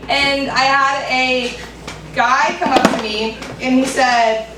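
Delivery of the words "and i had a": ordinary talk, nothing marked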